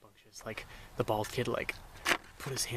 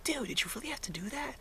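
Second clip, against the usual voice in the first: a hint of a higher teenage voice; mildly scolding his buddy; lightly voiced kid, softer; 13 semitones higher, 6 dB quieter than usual. Bald kid scolds his friend.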